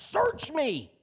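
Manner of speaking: slow pleading prayer to God; earnest prayer. Retold or imitated. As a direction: cry it out as a humble plea. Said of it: "when he cries out to God".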